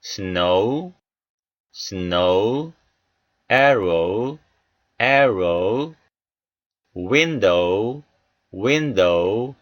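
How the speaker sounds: "snow, arrow, window, yellow" each ending on a long oh-oo glide; long O sound. Shown as a demonstration. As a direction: model "snow, arrow, window, yellow" word by word with drawn-out final vowels. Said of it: "장모음 오우 소리가 나는 단어들". ("snow, arrow, window, yellow" 끝을 '오우'로 길게 발음)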